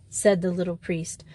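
usual speaking voice

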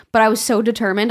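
ordinary delivery

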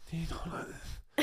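whispered nasal demo. Shown as a demonstration. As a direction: show a talking-through-the-nose voice. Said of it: "How do you speak through your nose?".

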